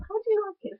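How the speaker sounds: high, loud mock telling-off; mock scolding. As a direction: scold an imaginary prankster, high up.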